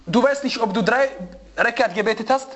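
normal talking voice

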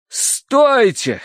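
high, drawn cry to stop; alarmed shout. A narrator's reading. Narrator stops Terence tipping the boat, alarmed shout. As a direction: shout a halt. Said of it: "крикнул я".

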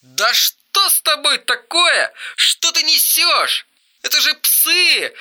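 a furious brother lecturing about dogs; angry rant. Acted. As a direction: berate him like an idiot. Eric rants angrily at brother.

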